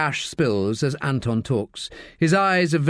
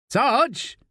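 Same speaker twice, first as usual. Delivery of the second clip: high-pitched call; 9 semitones higher than usual. A narrator's reading. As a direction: call out to your sergeant. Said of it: "calls Pulford".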